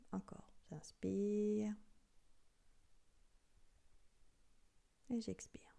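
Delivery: slow, soft breathing guidance; guided breathing. Acted. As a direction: guide each breath softly and slowly.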